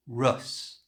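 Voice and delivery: "Rus." with lips pushed forward for the R; rounded lips R. Shown as a demonstration. Teacher demonstrating the R for learners. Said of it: "push your lips forward like this".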